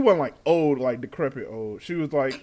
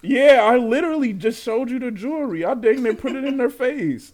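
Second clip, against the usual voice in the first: exasperated; 7 semitones higher, 5 dB louder than usual. Real, not acted.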